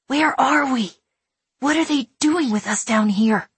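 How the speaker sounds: put-on a lost child demanding answers; frightened boy. A narrator's reading. Boy voice questions.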